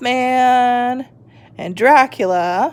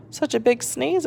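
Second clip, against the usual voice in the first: ordinary delivery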